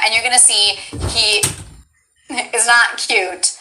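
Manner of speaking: ordinary talk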